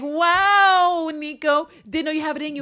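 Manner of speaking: high, loud, drawn-out wow; hammed-up astonished exclamation; mock amazement. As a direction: act hugely impressed by him. Acting out her mock amazement.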